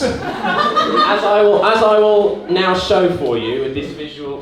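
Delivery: ordinary talk, nothing marked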